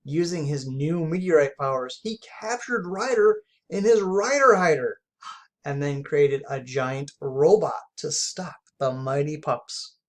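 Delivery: ordinary delivery, nothing marked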